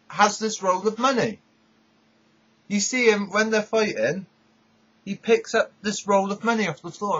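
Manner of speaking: ordinary delivery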